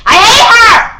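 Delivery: high, loud burst of real frustration; frustrated outburst. Real, not acted. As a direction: blurt it out, fed up with the teacher. Venting about the teacher.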